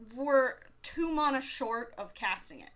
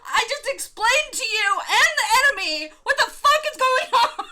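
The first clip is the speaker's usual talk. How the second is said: laughing exasperated outburst; 12 semitones higher, 11 dB louder than usual. Retold or imitated. Opponent to partner and enemies, a loud laughing exasperated outburst. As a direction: blow up while cracking up.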